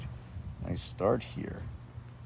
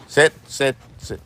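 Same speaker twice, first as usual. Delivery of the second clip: dog command; 6 semitones higher, 5 dB louder than usual. Real, not acted. Commanding the dogs.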